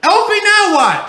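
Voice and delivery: loud, fed-up yell up high; exasperated shout. Real, not acted. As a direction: yell across the house, fed up. Parent yelling at the kid.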